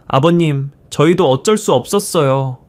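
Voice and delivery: ordinary delivery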